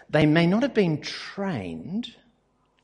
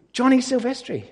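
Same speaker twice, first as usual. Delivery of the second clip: higher, clearer repeat of the name; repeated louder; 8 semitones higher, 3 dB louder than usual. Real, not acted. Repeating the name for a listener who missed it, higher. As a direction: say it again so they catch it. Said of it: "Say again?".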